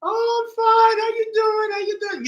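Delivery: very high, loud gleeful squeal; excited reply. Retold or imitated. Sister happily greeting her long-unseen brother, high excited squeal. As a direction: squeal back with joy at the reunion.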